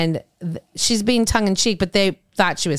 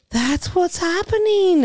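realization; 11 semitones higher, slower than usual. Retold or imitated. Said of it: "she looked at me like".

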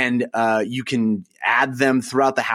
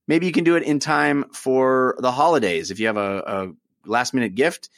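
ordinary talk, nothing marked

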